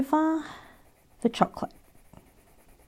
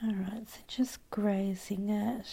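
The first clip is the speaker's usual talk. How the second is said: whispering; 11 dB quieter than usual. Real, not acted. Whispered asides while drawing.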